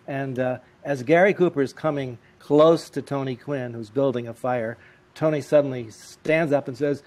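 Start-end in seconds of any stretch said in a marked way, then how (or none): none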